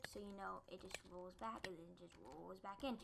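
ordinary talk

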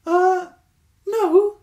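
high, loud squeaky badger voice; helpful Stuart. A narrator's reading. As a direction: chirp it up brightly and helpfully. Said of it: "said Stuart the Badger helpfully".

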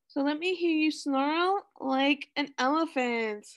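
normal talking voice

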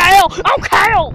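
put-on kid's toy hollering back. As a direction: drag out a defiant I don't care. Toy squabble, repeated retort.

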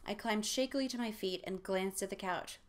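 normal talking voice

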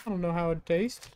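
normal talking voice

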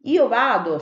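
ordinary delivery

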